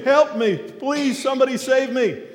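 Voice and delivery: drowning man crying out again; pleading scream. Retold or imitated. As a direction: beg to be saved from the water. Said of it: "The man's screaming".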